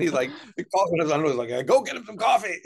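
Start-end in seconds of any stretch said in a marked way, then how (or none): 1.7-2.6 much higher, louder put-on voice; mock boss order. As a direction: bark it at the lackey, playfully.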